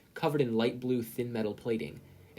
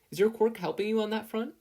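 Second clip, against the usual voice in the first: raised, gentle mother's voice; Inko's voice; 11 semitones higher, 4 dB louder than usual. A narrator's reading. Narrator voicing Inko asking Kathleen, higher and lighter.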